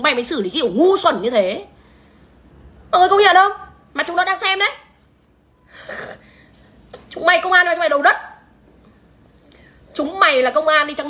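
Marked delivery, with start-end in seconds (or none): 2.9-8.2 raised, higher shouting tirade at the cops; furious livestream yelling; angry outburst. Real, not acted. Furious rant at the police.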